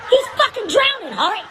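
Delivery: high, loud exasperated shout; exasperated yell. Retold or imitated. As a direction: yell it in disbelief. Other lifeguard yelling.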